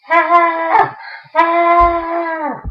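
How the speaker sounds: high, long scream of shock; two high shrieks at an awful sight; horrified scream. Acted. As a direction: scream at the sight of your date. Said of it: "What the hell you're screaming for?".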